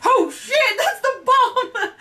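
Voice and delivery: very high, loud panicked squeal breaking into laughter; retold freak-out. Viewer suddenly spotting the bomb, shrieking freak-out. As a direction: shriek it in comic panic, drawn out. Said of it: "when you freaked out, I kind of, uh, caught up".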